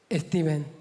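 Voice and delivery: Stephen said as Esteban, Spanish style; mum's Spanish accent. Retold or imitated. A daughter mimicking her Spanish mum saying Stephen as Esteban. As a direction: give it a hint of a Spanish mum. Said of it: "She calls him".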